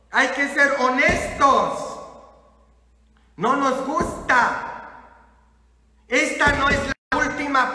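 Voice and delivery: loud, high, emphatic exhortation; forceful preacher's rebuke; fired-up preaching. Real, not acted. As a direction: rouse the congregation with force. Exhorts congregation at full force.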